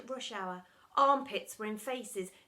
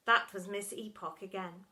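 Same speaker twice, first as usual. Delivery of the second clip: plain reading voice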